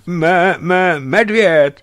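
high, loud, slow stammer of terror; stammering fright. A narrator's reading. Frightened hunter stammering to narrator, high stammer. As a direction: stutter the word out in panic. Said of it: "vysvětlil mi koktavým hlasem".

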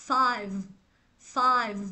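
"five" ending in a vibrating v; careful low-pitched "five", buzzing end; final v in "five". Shown as a demonstration. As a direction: model "five" low, teeth on lip for the last sound. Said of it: "mình tạo ra cái âm rung".

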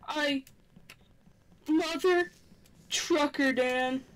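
ordinary talk, nothing marked

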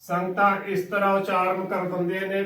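ordinary talk, nothing marked

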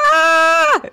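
short high scream; panicked scream. Real, not acted. Gamer screaming as babies wake, high panicked shriek. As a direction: let out a sharp alarmed shriek.

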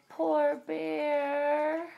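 ordinary delivery, nothing marked

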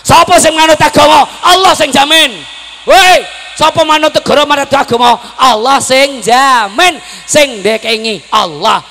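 high, booming rallying cry; fervent shouted sermon, slowing at the end; full-force preaching. Real, not acted. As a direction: shout the question, then hammer home the answer.

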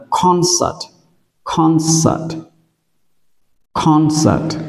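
CON in "concert" stressed, second syllable light; stress on first syllable. Shown as a demonstration. Teacher models "concert" for learners to repeat, first-syllable stress. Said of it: "trọng âm nó rơi âm tiết thứ nhất".

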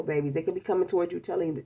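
normal talking voice

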